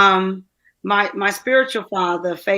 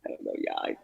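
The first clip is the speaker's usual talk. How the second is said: breathless unvoiced whisper; choked whisper; 10 semitones lower, 13 dB quieter, faster than usual. Real, not acted. Guest apologizing to hosts in a choked whisper. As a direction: whisper it, caught in the moment. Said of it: "I just got caught in a moment".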